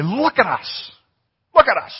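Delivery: loud, high call to look up; commanding call. Retold or imitated. Peter calling out to the beggar, loud and commanding. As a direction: demand the beggar's attention.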